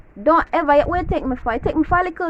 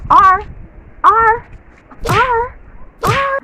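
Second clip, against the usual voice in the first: loud yelps climbing higher each time; escalating outburst; 8 semitones higher, 7 dB louder, much slower than usual. Acted. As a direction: repeat it in rising, hammed-up bursts. Acting out an escalating response.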